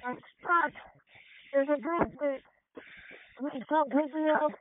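high, whiny fake-crying voice; high, mock-tearful begging; mock crying plea. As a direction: whimper it like a scared kid, hammed up. Joking trapped-firefighter plea.

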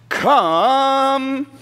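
loud high shout to call the mouse back; lion shouting. Acted. Lion shouts after the departing mouse, loud high call. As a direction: bellow it out across the jungle.

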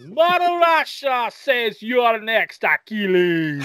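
high, slow mock Russian boast; hammed-up Russian taunt, laughing; Russian accent. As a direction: proclaim like a cartoon Russian villain. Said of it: "Great Russian accent".

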